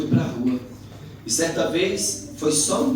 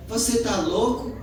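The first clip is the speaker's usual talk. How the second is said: indignant retort; 8 semitones higher than usual. Retold or imitated. Friend's angry retort, retold.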